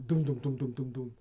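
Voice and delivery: normal talking voice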